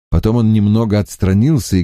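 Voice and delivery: plain reading voice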